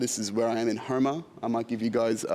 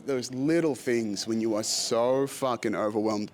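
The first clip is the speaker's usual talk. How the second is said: ordinary delivery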